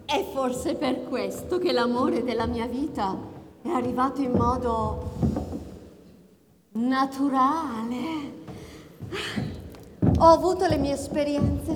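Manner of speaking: obsessive lovesick woman. Acted. Obsessive lover's monologue.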